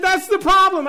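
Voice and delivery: high, loud yelled answer; imitating a frantic friend shouting; panicked retold reply. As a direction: yell back in mock desperation.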